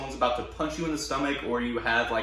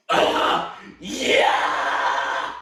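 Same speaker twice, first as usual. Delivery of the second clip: raspy scream on yeah; high scream; 4 dB louder than usual. Shown as a demonstration. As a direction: hit a high false chord. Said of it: "putting a consonant before the vowel for the high scream".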